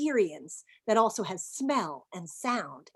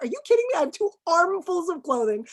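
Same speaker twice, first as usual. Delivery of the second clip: much higher, louder mock outrage; squeaky retold indignation; hammed-up disbelief; 7 semitones higher, 4 dB louder than usual. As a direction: play up the shock for laughs. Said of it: "I was like".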